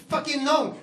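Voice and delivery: usual speaking voice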